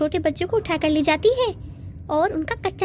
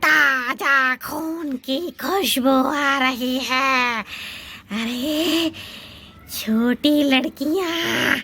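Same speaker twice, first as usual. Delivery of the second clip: slow low witch croon; 4 semitones lower, much slower than usual. Acted. Witch sniffing out little girls, slow low menace. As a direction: savour the smell like a hungry witch. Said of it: "एक चुड़ैल आ जाती है".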